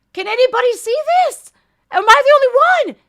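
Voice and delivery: high, loud disbelief at the clip; exasperated outcry. Real, not acted. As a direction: cry out for someone to agree. Outraged reaction to the clip.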